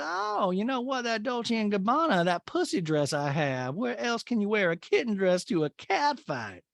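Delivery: high, swooping hammed-up impression; mock society matron. Woman discussing her party dress, high mock-grand delivery. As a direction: grandly show off in a put-on voice. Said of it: "she goes".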